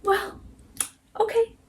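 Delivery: very high-pitched, would-be casual reply; squeaky attempt at playing it cool; trying to sound nonchalant. A narrator's reading. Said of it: "I tried to sound nonchalant and said".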